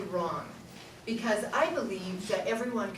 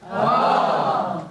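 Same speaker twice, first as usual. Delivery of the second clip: louder chesty oh; low register oh; 4 semitones lower, 6 dB louder than usual. Shown as a demonstration. Teacher repeating the low sound for the class, chesty oh. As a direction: give a low oh again. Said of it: "Do it again".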